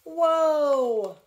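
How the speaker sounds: long, loud, high stop shout; yell. A narrator's reading. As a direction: yell desperately to stop the team. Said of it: "yelled Almanzo".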